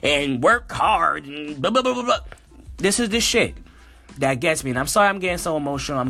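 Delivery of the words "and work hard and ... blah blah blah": slow mocking bootstraps lecture tailing into blah blah. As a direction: sneer at the advice while parroting it. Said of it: "love to tell us".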